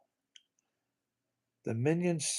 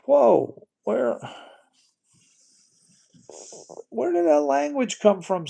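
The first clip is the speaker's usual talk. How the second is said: real startled outburst; shocked; 9 semitones higher than usual. Real, not acted. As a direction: caught off guard, voice shooting up. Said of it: "I'm very shocked".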